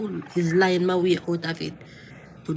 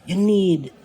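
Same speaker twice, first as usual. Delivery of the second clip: much louder, emphatic; a firm loud jab; sudden loud push; 8 dB louder than usual. Acted. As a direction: hit these words hard to get his attention. Scolding cellmate about anger.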